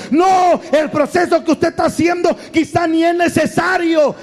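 high, indignant objection; hammed-up high-pitched objection; acted protest. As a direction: cut in and argue back in a high voice. Acts person arguing back to God.

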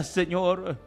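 usual speaking voice

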